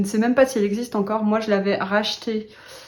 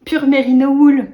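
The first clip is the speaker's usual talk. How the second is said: sarcastic label quote; 6 semitones higher, 10 dB louder than usual. Retold or imitated. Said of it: "mais bien sûr".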